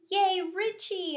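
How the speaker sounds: high squeaky cheer; squeaked. A narrator's reading. Hamster squeaking a cheer for Richie. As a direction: squeak it out, thrilled. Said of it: "I squeaked".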